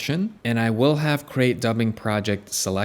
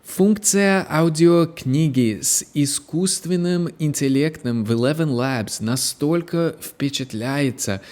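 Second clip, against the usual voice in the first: reading the Russian line herself, higher, with a light American accent; Russian with own accent; 4 semitones higher than usual. Shown as a demonstration. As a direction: demo her own Russian, slightly higher. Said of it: "I have a bit of an accent when I speak in Russian".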